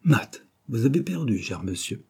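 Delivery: plain reading voice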